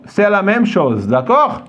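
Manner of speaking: ordinary talk, nothing marked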